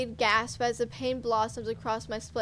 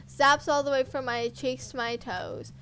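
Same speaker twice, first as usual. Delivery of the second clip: ordinary delivery, nothing marked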